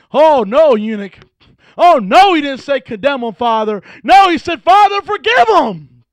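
high, loud, slowed preacher's cry; preacher crying out Jesus' words from the cross; full-force preaching. Acted. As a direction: ring out the forgiveness line.

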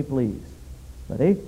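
ordinary talk

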